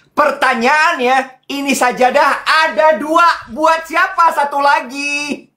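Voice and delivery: milk the question like a soap opera; mock dramatic question. Host teasing the gift giver about the second prayer mat, high mock drama.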